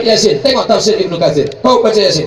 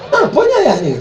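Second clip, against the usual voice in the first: puzzled; 10 semitones higher, faster than usual. Retold or imitated. Mock confused child.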